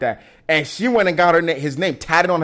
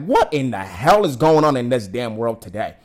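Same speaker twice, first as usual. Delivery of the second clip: ordinary talk, nothing marked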